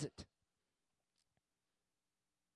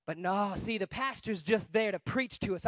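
ordinary delivery

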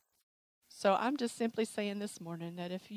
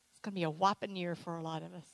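ordinary talk

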